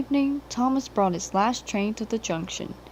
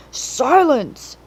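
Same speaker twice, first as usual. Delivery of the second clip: loud, higher commanding bellow; 5 semitones higher, 7 dB louder than usual. A narrator's reading. The Fat Controller silences the cheering engines, loud bellow. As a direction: silence the noisy engines with one bark. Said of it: "he bellowed".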